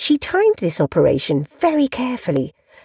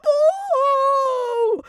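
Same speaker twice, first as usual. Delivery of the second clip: sudden pained cry, much higher; scream. Acted. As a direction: cry out in pain as the hat is yanked. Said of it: "he screamed".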